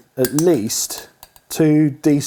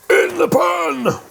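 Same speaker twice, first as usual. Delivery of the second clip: drawn-out call; much slower than usual. Acted. Dramatic call.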